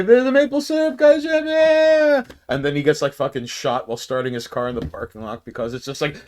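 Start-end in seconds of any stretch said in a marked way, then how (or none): 0.0-2.2 goofy cheering coworker. Acted. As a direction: cheer it like a cartoon office drone. Office cheering the maple idea.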